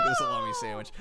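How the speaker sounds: very high, fast squeal; squealing mock sex talk. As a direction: rattle it off in a squeal. Mocking his own sex talk.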